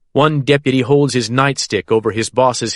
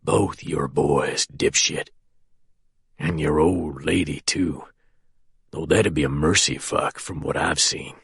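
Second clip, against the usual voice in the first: choked prisoner rasping threats at the sheriff; raspy whisper; 7 semitones lower, 6 dB quieter than usual. A narrator's reading. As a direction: rasp it out half-whispered. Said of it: "In a raspy whisper".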